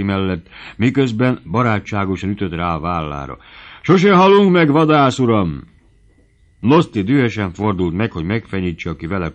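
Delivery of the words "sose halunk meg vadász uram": high, loud, jovial cry; exuberant good cheer. A narrator's reading. Merry dancer slaps the hunter's shoulder, crying out jovially. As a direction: call out heartily at full swing. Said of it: "Széles jókedvben ott rezegtette magát".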